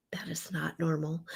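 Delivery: an unvoiced whisper of disbelief; a real, hushed verdict; disgusted whisper. Real, not acted. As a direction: whisper it, shaking your head. Disgusted aside after repeat.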